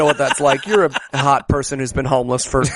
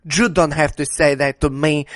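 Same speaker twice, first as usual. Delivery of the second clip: put-on caricatured Mexican accent; exaggerated Mexican-accented English; insulting Mexican accent; 3 semitones lower than usual. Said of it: "She has the most insulting accent".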